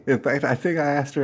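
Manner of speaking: ordinary delivery, nothing marked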